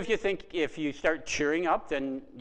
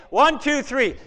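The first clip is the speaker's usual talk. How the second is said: usual speaking voice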